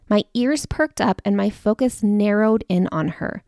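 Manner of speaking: ordinary talk, nothing marked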